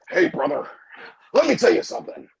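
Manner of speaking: lightly imitated wrestler's phone greeting, punched out; a loud, emphatic hint of a wrestling legend; Hulk Hogan. Calling back a wrestler after his promo, lightly imitated legend. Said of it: "so Hulk Hogan tells him".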